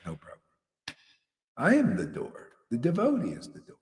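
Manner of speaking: ordinary talk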